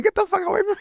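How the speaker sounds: very high imitated plea; panicked mock squeal. Voicing Timmy backed in the corner, panicked mock squeal. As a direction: squeal it like cornered prey, playing it up.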